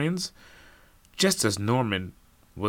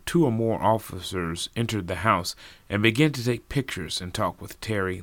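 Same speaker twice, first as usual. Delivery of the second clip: plain reading voice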